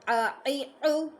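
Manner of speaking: आ ई ऊ slow, pressed from the throat; throaty ain vowels. Shown as a demonstration. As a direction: chant आ ई ऊ slowly with a throaty onset. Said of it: "आइन को आइन की तरह ही अदा करेंगे".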